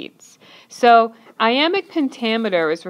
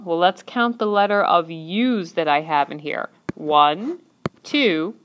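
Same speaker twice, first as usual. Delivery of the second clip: normal talking voice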